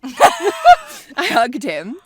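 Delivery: squealed high through a laugh; laughing shriek. Retold or imitated. Laughing shriek after the whisper.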